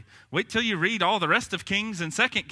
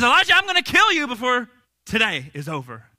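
higher, louder retold threat; threatening, in a storyteller's way; mock threat; 6 semitones higher, 6 dB louder than usual. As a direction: put some force into a retold death threat. Voicing Jezebel's threat in story.

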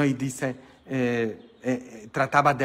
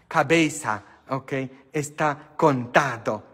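ordinary delivery

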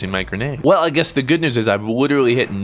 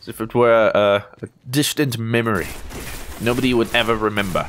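ordinary delivery

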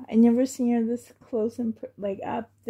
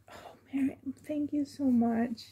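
tearful whisper; 2 semitones higher, 6 dB quieter, faster than usual. Real, not acted. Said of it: "I don't wanna cry".

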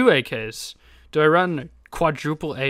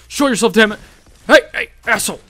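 loud, raised-pitch bellow at an opponent; angry challenge; 7 semitones higher, 9 dB louder, faster than usual. Real, not acted. Gamer calling out a hidden player, angry shout. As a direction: shout out the hiding enemy.

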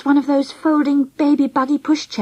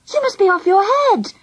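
higher, exasperated sour jab; sour retort; 8 semitones higher than usual. A narrator's reading. Friend scolding the baby-snatcher, sour. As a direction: throw it back sourly, pitched up. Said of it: "I said sourly".

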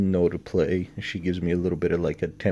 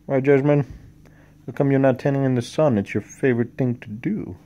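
higher, louder, fast doggy talk; pet voice; 7 semitones higher, 8 dB louder than usual. Real, not acted. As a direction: chatter brightly at the dog. Talking to dog in pet voice.

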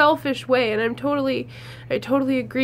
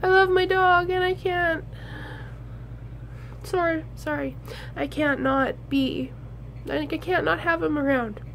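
higher, voice breaking with tears; fighting back real tears; tearful; 5 semitones higher, 3 dB quieter than usual. Real, not acted. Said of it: "I'm sorry, I'm emotional".

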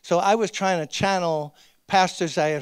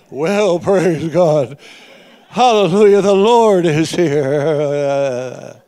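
slow, much louder, booming preacher cadence; mock pulpit preacher voice; 2 semitones lower, 9 dB louder, much slower than usual. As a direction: ham up the Sunday-pulpit holy voice. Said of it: "He wasn't talking like that".

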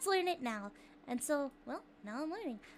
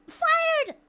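hammed-up furious shout, much louder and higher; mock outrage; 11 semitones higher, 10 dB louder than usual. Streamer mock-firing a chatter over the soda joke, hammed-up shouting. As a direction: yell it like a fake boss firing someone.

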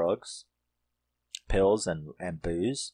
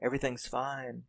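soothing mother; 3 semitones higher, 8 dB quieter than usual. Retold or imitated. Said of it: "she's like".

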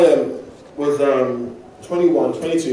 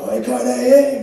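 mate impressed; 7 semitones higher than usual. Retold or imitated. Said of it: "he was like".

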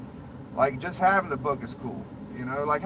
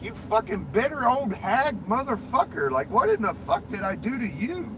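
higher-pitched, exasperated mock tirade; retold angry rant; 5 semitones higher than usual. Telling off the rude woman in the hallway, higher and exasperated. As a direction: vent at her like you finally said it aloud. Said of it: "I'm like".

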